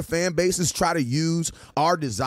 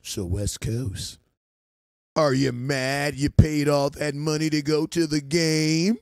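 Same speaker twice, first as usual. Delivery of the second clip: much lower, slow mock fan voice; imitating a fan; 5 semitones lower, slower than usual. Cowboy fan asking West Coast about game money, low slow mock voice. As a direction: drag it out deep like a goading fan. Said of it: "I had a Cowboy fan ask me".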